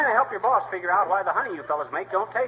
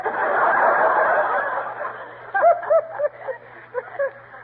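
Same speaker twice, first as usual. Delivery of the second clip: big laugh. Real, not acted. [name] laughs at his own joke.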